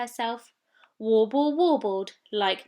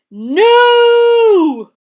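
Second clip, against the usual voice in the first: very loud, high shout; 12 semitones higher, 19 dB louder than usual. Acted. Whole huddle yells no at Warble, very loud. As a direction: bellow a flat refusal.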